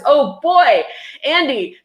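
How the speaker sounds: bursting in to object; exasperated protest at the author; indignant outburst. Real, not acted. Protesting the book's claim.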